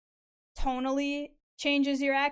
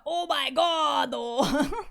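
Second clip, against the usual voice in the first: put-on Japanese accent; 3 semitones higher, 6 dB louder, much slower than usual. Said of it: "The Japanglish".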